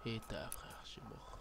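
whispering. Real, not acted. Muttered on dying in game.

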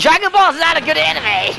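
high, loud protest; indignant outburst. Real, not acted. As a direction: shoot the idea down hard. Heated anime argument.

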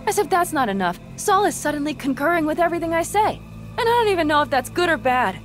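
loud, high, frustrated rant; exasperated venting. Acted. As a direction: let the worries burst out. Venting worries to a friend.

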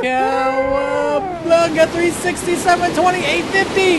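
high, drawn-out gleeful yell; excited cry. Real, not acted. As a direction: shout it out with glee. Gleeful cry during a wild jump.

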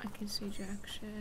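soft unvoiced whisper, under the breath. Real, not acted. Player grumbles in the dark, unvoiced whisper. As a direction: hiss it quietly in the dark.

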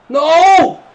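loud, high cry; dismayed shout. Real, not acted. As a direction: yell in dismay. Chance missed.

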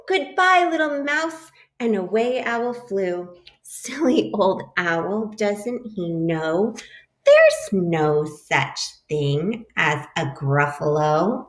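lively picture-book read-aloud; animated storybook voice for a class; reading to children. A narrator's reading. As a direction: read it like story time for little kids.

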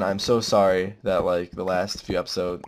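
ordinary talk, nothing marked

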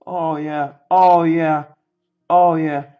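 triumphant cheering. Real, not acted. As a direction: belt out your satisfaction. Celebrates the sleeved card.

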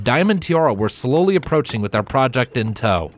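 ordinary delivery, nothing marked

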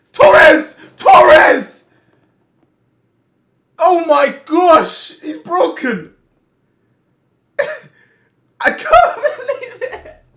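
high, loud celebratory shrieks with laughter; ecstatic screaming. Real, not acted. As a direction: scream in joy at the goal. Winning goal scored.

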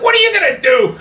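high, loud cocky comeback; retold defiant challenge. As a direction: throw the dare back, half laughing. Comedian challenges the angry husband.

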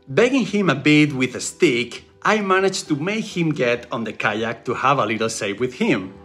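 normal talking voice

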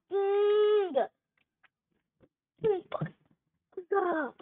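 angry shout. Real, not acted. Raging at the game.

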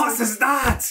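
high-pitched, intense fake German; mock German accent. Cohost takes up the accent request, high mock-German accent. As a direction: try an angry German accent, playfully. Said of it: "like, a German or Russian accent".

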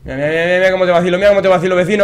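goading, rising steadily to a high shout; building taunt. Real, not acted. Gamer teasing the neighbour, taunt building to a shout.

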